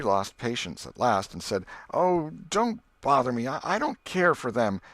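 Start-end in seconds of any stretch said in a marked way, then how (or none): 1.9-4.8 higher, louder, near tears; tearful snapping. Acted. Becky snapping at Alfred, near tears. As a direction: push him off on the verge of crying. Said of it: "burst into tears".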